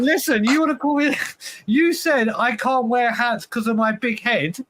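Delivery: high, laughing mock outrage; laughing protest. Real, not acted. Cohost laughingly quotes his friend's own big-head line back at him. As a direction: laugh through the accusation.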